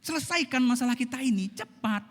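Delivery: demanding wife. Acted. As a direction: hurry him to fix it.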